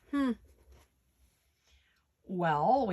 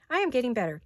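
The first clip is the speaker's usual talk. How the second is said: ordinary delivery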